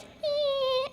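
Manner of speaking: high, thin creaking-door noise; imitated door creak. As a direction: squeak it like a door at midnight. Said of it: "we hear this creak".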